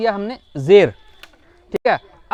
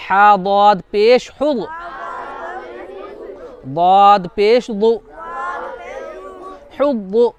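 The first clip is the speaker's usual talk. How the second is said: spelling drill; slower than usual. Shown as a demonstration. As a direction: chant each letter slowly for the class. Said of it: "उसके बाद देखो".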